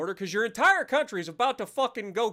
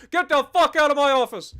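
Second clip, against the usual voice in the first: hammed-up furious president yelling, louder; mock angry shout; 6 semitones higher, 8 dB louder than usual. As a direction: bark the order like a pretend-offended boss. Mock president throwing someone out.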